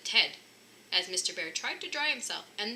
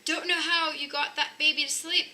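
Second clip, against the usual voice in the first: Miss Grizzly Bear; 7 semitones higher, 6 dB louder than usual. A narrator's reading.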